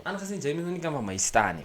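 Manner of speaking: ordinary talk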